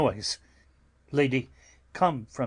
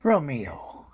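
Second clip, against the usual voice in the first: whisper. Acted.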